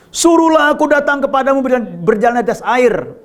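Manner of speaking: high, proclaimed challenge; Peter calling out. Acted. Preacher re-voicing Peter's demand to Jesus, high calling voice. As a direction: pitch it up like a bold request. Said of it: "Petrus berseru dan menjawab dia".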